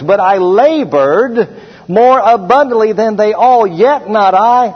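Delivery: building pulpit fervor; preaching voice. Acted. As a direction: preach it big and slow.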